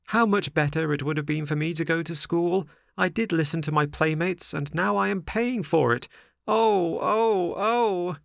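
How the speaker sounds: high, wailing plea through tears; crying Pinocchio. A narrator's reading. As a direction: beg and wail like a weeping puppet boy. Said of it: "With tears streaming down his cheeks".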